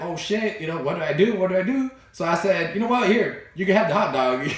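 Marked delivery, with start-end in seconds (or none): 0.0-1.9 high-pitched retold panic; freaking out. Boy who just bit a kid's finger, panicking. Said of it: "I start freaking out". 2.7-4.5 high, hurried-to-appease retold plea; flustered pleading. Biter offers hot dog to crying boy, flustered.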